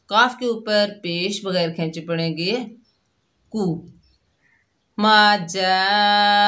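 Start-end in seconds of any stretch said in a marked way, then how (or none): 5.0-6.5 जाअत stretched four to five counts, slow and louder; long madd demo. Shown as a demonstration. Teacher showing students madd muttasil, slow drawn recitation. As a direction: draw out मा जाअत in slow Quranic chant. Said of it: "चार या पाँच अलिफ की मिकदार खींच कर".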